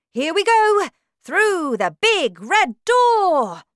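high, loud, drawn-out rallying cry; excited calling out. A narrator's reading. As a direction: lead the children off with gusto. Wonka excitedly leading visitors inside.

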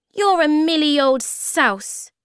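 high, loud, drawn-out insult; indignant little creature voice; tiny creature's retort. A narrator's reading. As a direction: squeak the insult back slowly.

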